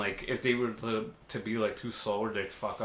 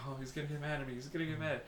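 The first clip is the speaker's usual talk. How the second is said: small, quiet, higher fretting; mock worry; 3 semitones higher, 11 dB quieter, faster than usual. Employees worried the boss will get mad, mock fearful. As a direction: imitate nervous workers fretting softly.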